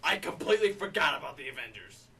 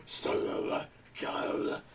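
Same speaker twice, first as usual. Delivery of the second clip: slow, voiceless choked rasp; choked Batman voice; much slower than usual. Retold or imitated. Batman being choked by Bane, mock strangled rasp. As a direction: strangle the words, hammed-up Batman. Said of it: "I'm getting choked by Bane".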